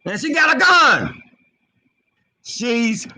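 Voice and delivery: high-pitched indignant exclamation; real high outburst of alarm; alarmed outcry. Real, not acted. Alarmed outcry about the cop.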